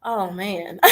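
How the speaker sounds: normal talking voice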